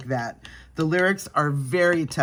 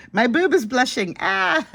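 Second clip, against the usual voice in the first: high, louder amused squeal; surprised laughing squeal; 10 semitones higher, 5 dB louder than usual. Real, not acted. Blush falls on her shirt, laughing squeal.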